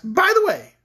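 high, loud, slowing to a drawn-out question; spring the question on a frightened victim; teasing queen. A narrator's reading. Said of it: "she laughed at his frightened look and asked".

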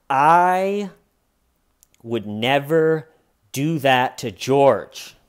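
loud, drawn-out declaration of innocence; mock solemn protest. Suspect denies it to detectives, mock emphatic protest. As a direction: imitate a grand denial, word by slow word.

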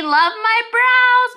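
high, slow gushing over brows; delighted squeal. Real, not acted. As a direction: gush with joy, drawn out. Delighted about new brows.

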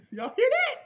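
gleeful laughing question. Real, not acted. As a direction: laugh it out to the congregation. Preacher delighted by scripture.

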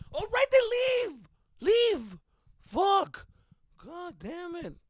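high, slow, loud furious shout; mock yell. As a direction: bellow at her to get out. Retold yelling at mom.

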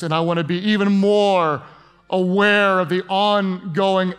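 heartfelt preacher's prayer with lifted hands; pastoral prayer. Acted. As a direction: lead the congregation in earnest prayer. Pastor leads congregation in prayer.

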